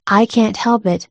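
ordinary delivery

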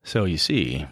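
slow with long pauses. Shown as a demonstration. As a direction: drag out every phrase. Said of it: "slow it down".

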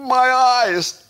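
high, loud drawn-out wail; mock agony. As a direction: cry out in hammed-up horror at the sight. Recoils at a sight, mock agony.

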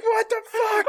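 high shocked outburst; hammed-up disbelief; overacted startled yell; mock shock. [name] shocked again.

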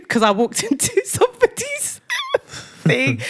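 high, squeaky laughing speech; real giggles breaking up the sentence; laughing through words. Real, not acted. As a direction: barely get it out for laughing. Laughs while recalling her intrusion.

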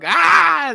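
a sudden high startling yell; playful boo shout; mock scare shout. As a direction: jump out and shout to scare passers-by. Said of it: "Así, asustando a la gente".

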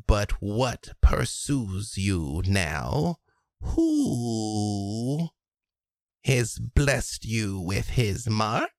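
slow, drawn-out crone savoring each word; a covetous hag, lingering and slow; old hag voice. Acted. Hag to Tatiana, slowly admiring her scars. Hag continues offering knowledge.

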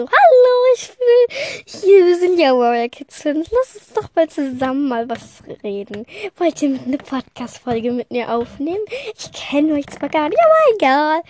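viel höher und lauter, überdreht begeistert; stürm auf Fremde zu, völlig übereifrig; übertrieben aufgedreht. Pretend. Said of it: "so würde ich jetzt nicht reagieren". (übertrieben aufdringliche Begrüßung)

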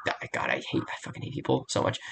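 whispered grumble, barely voiced; muttered under breath. Real, not acted. Annoyed at online commenters, whispering the grumble under his breath. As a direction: hiss it to yourself in frustration.

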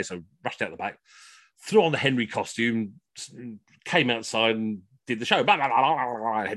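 ordinary delivery

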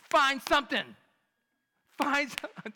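loud mock boss commanding; commanding shout. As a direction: order the staff about. Supervisor ordering staff to help.

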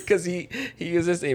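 barely holding back a laugh; stifled giggles. Real, not acted. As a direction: laugh leaking through. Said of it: "I'm trying not to laugh".

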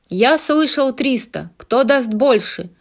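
loud auctioneer call. Acted. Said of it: "продолжал выкрикивать аукционист".

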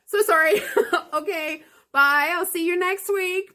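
real giggly apology and farewell; laughing goodbye. Real, not acted. Reader laughing as she signs off to listeners.

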